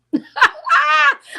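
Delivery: loud, high-pitched burst of laughter; big high cackling laugh. Real, not acted. Laughs at her own hair story.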